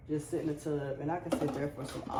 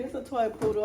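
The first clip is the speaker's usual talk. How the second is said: ordinary delivery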